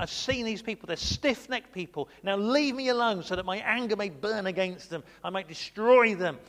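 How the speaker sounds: God angrily tells Moses his intent; angry God. Retold or imitated. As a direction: let God's anger build up. God's angry speech.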